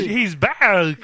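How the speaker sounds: slow loud goofy voice; cartoon character voice. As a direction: drag it like a cartoon dimwit. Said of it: "a character that Dave created".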